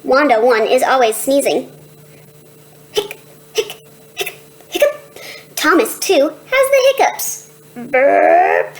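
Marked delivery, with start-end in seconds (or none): 2.9-4.9 hiccups. Acted. Sibling hiccups. 7.9-8.7 burp. Acted. Sibling burps.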